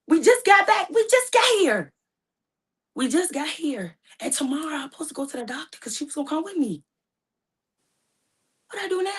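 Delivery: distressed. Real, not acted. Shock after mother's death.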